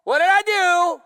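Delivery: loud, slowed, desperate cry to God; shouting at God. Retold or imitated. Preacher retelling his lonely morning, shouting to God. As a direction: cry out to God, where are you. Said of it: "I'm over there screaming".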